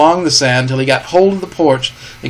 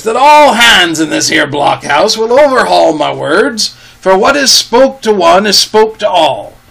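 pirate calling out louder to the whole blockhouse; raised voice; 5 semitones higher, 5 dB louder than usual. A narrator's reading. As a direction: project it so every man inside hears. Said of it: "raising his voice".

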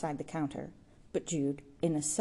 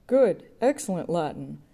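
mock cry; 7 dB louder than usual. A narrator's reading. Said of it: "cried one of the undergraduates".

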